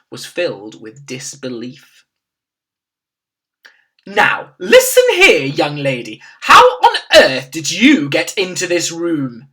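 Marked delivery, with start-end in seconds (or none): 4.1-9.5 high, louder indignant demand; furious shout. A narrator's reading. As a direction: demand answers sharply. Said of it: "the maid shouted furiously".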